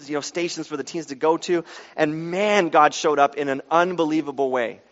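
ordinary delivery

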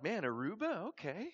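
can't believe his luck; delighted surprise. Retold or imitated. Young missionary hearing he's sent to Aruba, thrilled. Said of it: "I'm like".

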